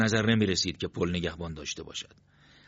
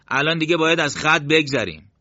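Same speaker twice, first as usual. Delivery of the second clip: higher, louder push on «از خط بگذریم»; pointed emphasis; 8 semitones higher, 7 dB louder than usual. A narrator's reading. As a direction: stress «از خط بگذریم» as a reminder, voice raised.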